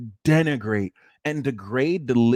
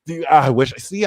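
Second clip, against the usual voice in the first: ordinary delivery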